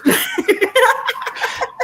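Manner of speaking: high giggling laugh. Real, not acted. Laughing at her corn-grows-wild joke, high giggle. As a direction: crack up at the joke.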